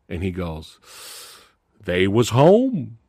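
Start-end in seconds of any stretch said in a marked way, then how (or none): 1.8-3.0 slow, flat deadpan delivery; slow deadpan punchline. Retold or imitated. Inmate explaining why he killed his family, slow deadpan. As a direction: drop the punchline slowly.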